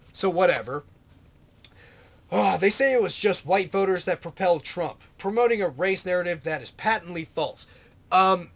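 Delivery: ordinary talk, nothing marked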